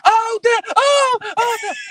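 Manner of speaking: high, loud hammed-up cries of pain; fake scream. Son faking pain for his dad's belt. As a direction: pretend to be whipped, wailing. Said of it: "scream like I'm hitting you".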